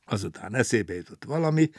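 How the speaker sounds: usual speaking voice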